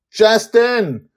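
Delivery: high, loud scolding shout; shouted name. A narrator's reading. Susie calls Justin out amid the meteors, shouting. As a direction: shout to jolt him awake. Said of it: "Susie shouts at him".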